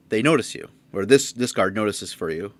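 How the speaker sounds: ordinary talk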